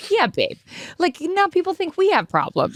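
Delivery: high, sing-song Canadian accent, playing her husband; imitating a sweet Canadian boyfriend. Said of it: "sweet little Canadian accent".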